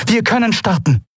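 high voiced calm announcement; announcing Aylan. A narrator's reading. Aylan ready to leave.

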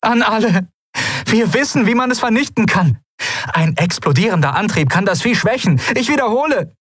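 very high, urgent call to everyone; urgent broadcast. Acted. Woman broadcasting the monster's weakness to all ships, urgent and high. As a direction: send out the alert high-pitched and insistent. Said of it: "eine vertraute Stimme rauschte über den Kubus".

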